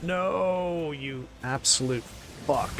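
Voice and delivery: high, stretched cry of real exasperation; exasperated outburst. Real, not acted. Streamer cursing mid-game, exasperated.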